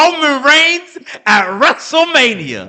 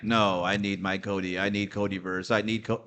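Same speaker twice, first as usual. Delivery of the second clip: low, soft mock-whining fan; whiny fan; 14 semitones lower, 11 dB quieter than usual. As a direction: mumble it like a sulky fan. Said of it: "And now you're like".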